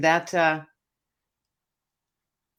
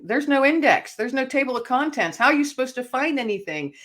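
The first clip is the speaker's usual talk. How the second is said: higher, exasperated rant at the Bible; frustrated outburst; 7 semitones higher than usual. Retold or imitated. Retelling her frantic search for a Bible passage, higher exasperated.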